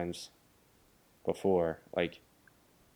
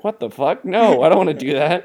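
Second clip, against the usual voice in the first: mock outraged yell; 6 semitones higher, 10 dB louder than usual. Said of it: "He's like".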